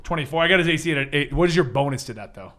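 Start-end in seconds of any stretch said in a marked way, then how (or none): none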